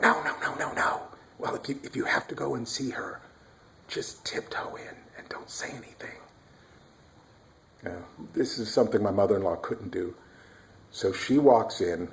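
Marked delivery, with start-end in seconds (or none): 0.0-6.2 hushed, pleading whisper; urgent whisper. Retold or imitated. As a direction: whisper to stop her waking the baby. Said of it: "And we went".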